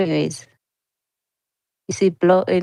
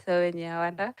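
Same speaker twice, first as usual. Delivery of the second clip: ordinary talk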